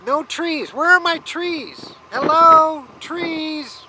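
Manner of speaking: mock-shout for the trees as if they'd answer; exasperated call. Real, not acted. Exasperated at the treeless desert.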